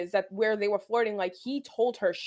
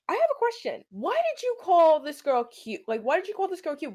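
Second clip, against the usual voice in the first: retold pissed-off confrontation; 8 semitones higher than usual. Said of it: "and I'm pissed".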